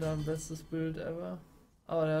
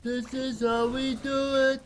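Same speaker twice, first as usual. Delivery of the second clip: gloating sing-song; 7 semitones higher, 5 dB louder than usual. Pretend. Gamer quotes song line, gloating.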